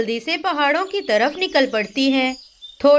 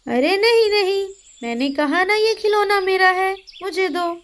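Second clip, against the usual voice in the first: put-on high boyish bossy voice; a little brother grabbing a toy; child voice; 5 semitones higher than usual. Older brother demanding the toy from his sister, childish high voice. Tilu insists.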